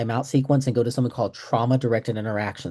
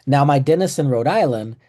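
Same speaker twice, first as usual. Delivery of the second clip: normal talking voice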